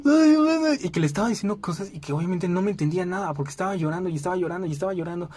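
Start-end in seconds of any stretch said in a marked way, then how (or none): 0.1-0.7 much higher, louder blubbering wail; babbling through loud sobs; bawling like a kid. Retold or imitated. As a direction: howl it like a small child crying. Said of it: "me puse a berrear, así como niño chiquito".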